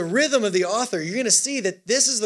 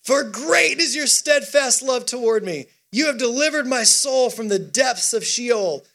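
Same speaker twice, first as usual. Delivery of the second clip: raised, emphatic proclamation; psalm reading with gusto; 4 semitones higher than usual. Acted. Preacher urging a sluggish congregation on, reading with gusto. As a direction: declaim it boldly to rouse the room.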